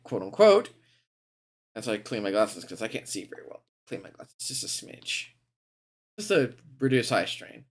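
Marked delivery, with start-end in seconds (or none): 3.9-5.3 whispered aside. Real, not acted. Aside while cleaning glasses.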